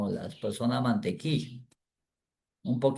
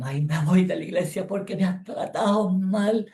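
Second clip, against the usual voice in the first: mock weepy complaint; 6 semitones higher than usual. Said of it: "con llanto".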